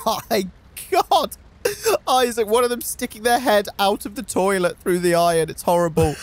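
half-laughing, horrified at the pig; laughing in horror; laughing disgust. Real, not acted. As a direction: shriek through laughter at the gross sight. Pig pops out of toilet.

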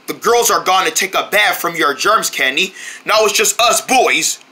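ordinary talk

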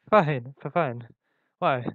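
flustered stammer on a repeated word. A narrator's reading. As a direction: stumble over the first answer, then recover. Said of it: "I stammered".

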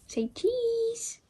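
tiny, very high squeaky voice; cutesy dog talk. Real, not acted. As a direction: squeak it sweetly to your dog. Posing dog for the camera.

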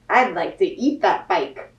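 a hint of a hungry goat; lightly voiced goat. Goat thinking about eating the bike, lightly voiced.

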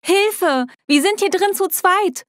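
high-pitched acted cry for help; shouting for help. A narrator's reading. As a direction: call out urgently, voice pitched well up. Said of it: "brüllte ich".

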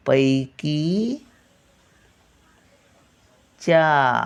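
पैकीच्या stretched out very slowly, पै with its full two-part vowel; teacher slowly models the word; very slow sounding out. Shown as a demonstration. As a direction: draw पैकीच्या out syllable by syllable. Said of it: "पै, हा बघा, दोन मात्रावाला".

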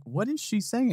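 high incredulous question; baffled host. Retold or imitated. Host Steve asking the family, high and baffled. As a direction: ask the family in disbelief. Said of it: "he looks at the family like".

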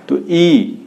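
ई drawn out, clearly voiced through the mouth only; teacher modelling a pure oral vowel; vowel demo. Shown as a demonstration. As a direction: hold ई so only the mouth sounds. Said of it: "तो बोल के देखिए".

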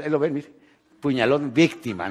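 usual speaking voice

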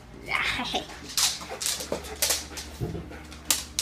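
airy wheezing laugh; breathless laughter. Real, not acted. Laughing after shout.

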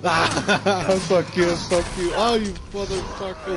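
yelling in panic; panicked screaming. Real, not acted. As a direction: scream it out in fright. Panicked screaming at game monster.

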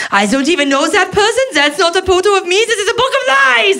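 put-on rising high, indignant old woman; grandma. Grandma rejecting the memory book, rising high and indignant. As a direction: build to a shrill outraged rejection of the book. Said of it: "she goes".

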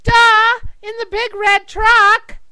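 loud, high punchline; hammed-up it's-so-obvious shout; exasperated duh. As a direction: blare it like they're clueless.

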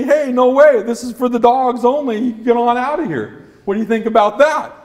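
cheeky high-pitched rebuff; mock refusal. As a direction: rehearse a defiant send-off as a dog. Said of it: "we'll say".

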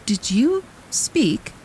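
high, slow, wondering question. A narrator's reading. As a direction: ask it slowly and high, amazed the scarecrow spoke. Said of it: "in wonder".

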